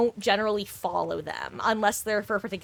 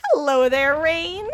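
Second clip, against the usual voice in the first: high, louder hello there; chirpy welcome, pitched up; bright greeting; 6 semitones higher, 5 dB louder, slower than usual. Real, not acted. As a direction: call out a cheery greeting.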